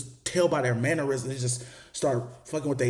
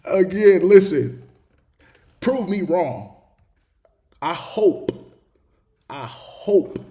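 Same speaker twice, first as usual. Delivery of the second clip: loud, insistent call for attention; emphatic; 6 semitones higher, 7 dB louder, much slower than usual. Real, not acted. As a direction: raise it, demand they listen. Addressing viewers emphatically.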